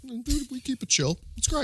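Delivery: high, light joking voice; mock laid-back dude. Friend playing future soldier John downplaying it, mock casual. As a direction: play it breezy and unbothered. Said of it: "yeah, John goes".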